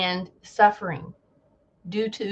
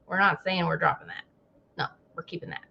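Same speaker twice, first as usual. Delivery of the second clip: much softer, quick muttered repeat; muttered aside; 6 semitones lower, 12 dB quieter, much faster than usual. Retold or imitated. Mimicking the CDC muttering a hedge. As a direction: mutter it under your breath. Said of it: "It's like".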